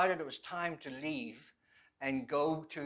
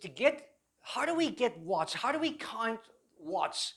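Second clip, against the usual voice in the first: a higher, instructor's quizzing voice, the last question slowed; petty officer quizzing; 5 semitones higher than usual. Retold or imitated. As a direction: put a question to the class like a naval instructor. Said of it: "he said".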